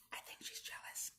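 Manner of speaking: a secretive whisper; whispered aside. A narrator's reading. Herbie confides to the listener about Ella, whispered aside.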